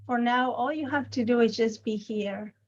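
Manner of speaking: unhurried meditation guide with long silences between phrases; guided meditation. Acted. Teacher guiding a group's attention to the body, calm guided meditation. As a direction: gently lead them into noticing the body, leaving lots of space.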